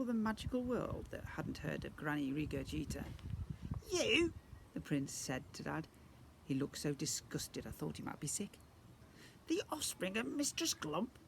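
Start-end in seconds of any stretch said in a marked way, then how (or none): 3.9-4.3 high, louder scornful question; disgusted squeak. Acted. Goblin prince sneers at Dad, disgusted squeak. 9.5-11.2 disgusted squeak. Acted. Goblin prince sneers at Dad, disgusted squeak.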